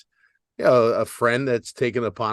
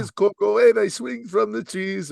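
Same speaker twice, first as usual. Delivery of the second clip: higher cartoon cereal-mascot voice; sing-out mascot character, pitched up; Coco; 7 semitones higher than usual. Shown as a demonstration. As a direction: put on a high commercial mascot voice. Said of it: "a subtle difference. Listen".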